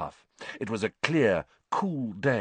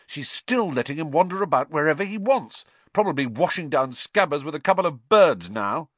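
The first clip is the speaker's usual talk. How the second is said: sharp, raised angry rant; furious; 7 semitones higher, 6 dB louder than usual. Acted. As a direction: spit it out in anger. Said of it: "Ron said furiously".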